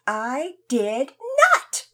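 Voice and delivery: loud, high protest; insistent cry. Acted. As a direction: cry out in denial. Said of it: "Lizzie insisted".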